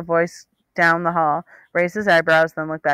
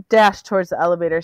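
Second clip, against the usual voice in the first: ordinary delivery, nothing marked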